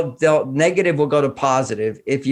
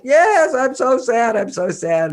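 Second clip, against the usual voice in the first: put-on sad child; 11 semitones higher, slower than usual. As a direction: whine like a small kid. Child voiced in story.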